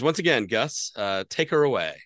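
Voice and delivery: normal talking voice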